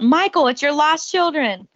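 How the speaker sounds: mock yell. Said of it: "Chris Tucker yells out".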